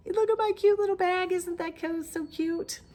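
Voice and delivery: high, gushing squeal over the bag; gushing over cute. Real, not acted. Knitter showing off her cute little project bag, high gushing. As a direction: coo at it like something adorable.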